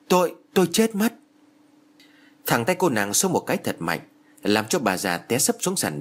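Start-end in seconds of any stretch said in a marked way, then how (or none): 0.1-1.1 forcing words out while being choked; choked cry. A narrator's reading. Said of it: "cố kêu".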